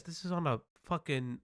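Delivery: ordinary talk, nothing marked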